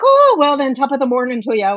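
high, loud, hammed-up Irish greeting; mock Irish accent. Tourist teasing an Irish vendor, mock Irish accent. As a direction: greet him in a jokey stage-Irish accent. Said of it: "I was talking in a British accent".

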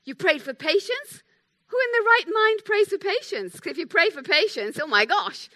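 high, loud mock disbelief; incredulous retort. Pastor retelling her reaction to a friend's prayer, incredulous. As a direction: react aghast, pitch leaping up. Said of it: "my response was sort of like".

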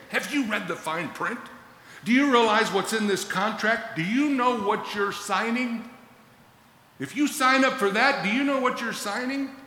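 high, urgent friendly-lawyer plea; rising, insistent warning before the signing. Acted. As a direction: press them like a lawyer protecting a client. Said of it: "This is like a friendly lawyer".

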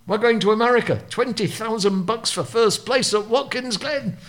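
high-pitched retold shout; imitated shout of big news; mock excited shout. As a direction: call it out high, like an excited team boss announcing. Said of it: "Sid Taylor shouts".

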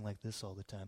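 hushed, low, serene movie-saviour tone; very soft, low and soothing; mock calm voice. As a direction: imitate an overly placid holy man. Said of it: "in a real calm voice".

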